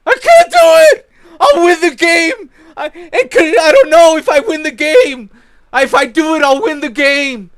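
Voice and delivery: very high, loud whimpering wail, slowing on the last lines; hammed-up sobbing footballer, easing down toward the end; mock whining Messi. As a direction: wail like a crying player too scared to win. Ranting as Messi about the missed pass.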